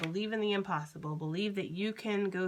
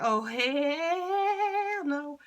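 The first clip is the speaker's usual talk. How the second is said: mock outraged refusal; 9 semitones higher, 5 dB louder, much slower than usual. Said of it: "For some of you that's like".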